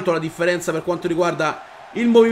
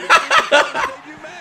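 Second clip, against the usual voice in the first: shrieking laugh, much higher; high burst of laughter. Real, not acted. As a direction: let out a high cackle of delight. Laughs at the game.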